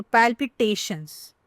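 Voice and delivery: palpitations said slowly, stress on TA. Shown as a demonstration. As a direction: draw out palpitations, leaning on the TA syllable. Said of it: "the stress is in the ta".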